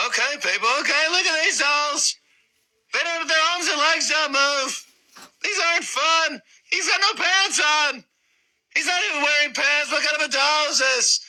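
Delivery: very high, strained comedian impression; high-pitched caricature of a comic complaining; Jerry Seinfeld. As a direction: push it way up high, exasperated observational stand-up.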